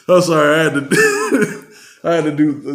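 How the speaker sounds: laughing apology, high. Real, not acted. Podcaster laughing while apologizing for impression. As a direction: giggle through the apology. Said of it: "laughing after impression".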